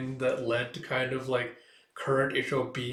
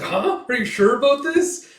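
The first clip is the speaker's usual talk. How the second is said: very high, much louder disbelief; shocked high outburst; 12 semitones higher, 11 dB louder than usual. Acted. The student questions Kuroku's risky plan, shocked and loud. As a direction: jump up in alarm.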